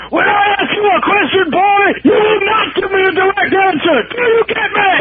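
barked high drill sergeant shout. Retold or imitated. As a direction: yell it at a recruit. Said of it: "like a drill sergeant".

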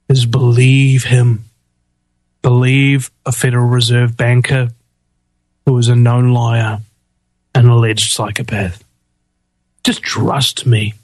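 low, heavy sarcastic delivery; low, deliberate mock reassurance. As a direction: spell it out in a deep, mock-sincere voice. Sarcastically urges trust, voices Key.

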